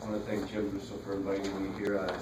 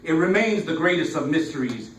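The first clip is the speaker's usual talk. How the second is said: fable-like verse, phrases opening loud and tapering; poetry recital; 4 semitones higher, 10 dB louder than usual. Acted. Poet telling an audience the hummingbird's legend, declaimed.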